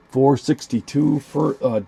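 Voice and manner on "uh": ordinary talk, nothing marked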